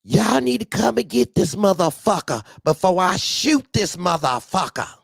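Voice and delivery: high loud furious woman's voice; angry woman caller. Retold or imitated. A woman calls the boss to come get the driver, high angry woman's voice. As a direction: threaten like a fed-up woman ready to shoot. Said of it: "This time it's a female".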